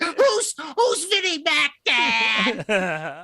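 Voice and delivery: put-on very high little kid calling his dad. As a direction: squeak it out like a small child. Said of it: "that's my impression of kids".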